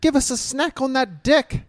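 hammed-up New Zealand bloke; Kiwi accent. Mocking the sexiest accent winner, high Kiwi voice. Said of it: "New Zealand, bro".

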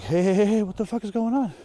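suddenly alarmed, reaching out; alarmed dad. Retold or imitated.

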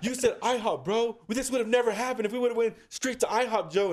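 high-pitched, exasperated rant in imitation; mock-indignant complaint to a buddy; retold angry blaming. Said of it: "This is your fucking fault".

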